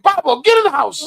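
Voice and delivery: high, loud mock scolding of the dog; hammed-up angry owner shouting at the dog; yelling at the dog. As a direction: pretend furious yell at Pablo. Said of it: "that would be my mental every time".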